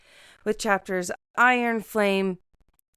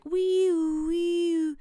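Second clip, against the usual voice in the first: high mock police siren; playful wee-oo siren call; siren imitation; 10 semitones higher than usual. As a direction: wail like a cop car.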